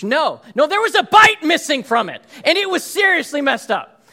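ordinary talk, nothing marked

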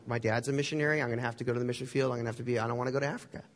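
low, very fast worried rattle; rushed reluctant protest. Retold or imitated. Friend retelling his dread of the mission field, rushed protest.

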